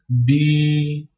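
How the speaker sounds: "bi" with the i held long and level; prolonged i. Shown as a demonstration. Teacher showing what the ya does, "bi" drawn out long. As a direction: stretch the vowel of "bi" right out. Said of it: "il faut prolonger le i".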